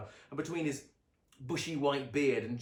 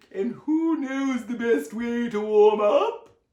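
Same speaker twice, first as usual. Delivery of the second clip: high, loud, slow teasing question; Aunt Summer teases Sonny, slow loud playful question; 11 semitones higher, 13 dB louder, slower than usual. A narrator's reading.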